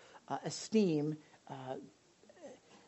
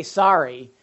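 excuse-making sorry; 2 semitones higher, 6 dB louder than usual. Shown as a demonstration. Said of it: "Don't just say".

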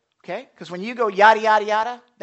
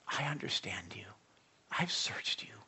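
a soft unvoiced whisper, much softer; 5 semitones lower, 11 dB quieter than usual. Preacher voicing God whispering to his people. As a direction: breathe it like a secret.

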